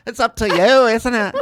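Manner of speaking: loud, high Scottish brogue of Gary John Bishop. Retold or imitated. Friend mimics Gary John Bishop for a laughing co-host, in a loud Scottish brogue. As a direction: belt it out in a hammed-up Scottish brogue. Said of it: "he's like".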